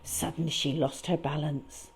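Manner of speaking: ordinary delivery, nothing marked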